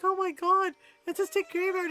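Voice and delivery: high, loud mock horror; hammed-up horse in alarm; panicky horse. Horse sees a pile of sticks, mock horror. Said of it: "he's like".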